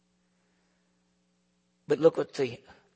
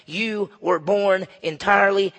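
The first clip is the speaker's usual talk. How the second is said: slow, loud, raised-pitch condemnation; mad; 4 semitones higher, 6 dB louder, slower than usual. Acted. Leaders furious at the beggar who showed them up, mad. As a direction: weigh out each word as an angry accusation. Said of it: "They are so mad at this man".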